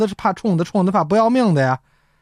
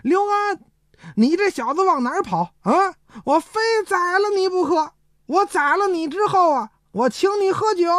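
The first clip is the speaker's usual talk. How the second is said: crazed yelling; 10 semitones higher than usual. Acted. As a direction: holler like a madman chasing his victim. Zhang Cheng chases, feigning madness. (装疯高喊)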